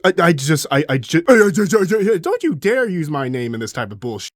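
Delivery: stammering, high-pitched indignation; sputtering outrage. Acted. Galba accused of rebellion, sputtering with outrage. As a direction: trip over your words in mock outrage.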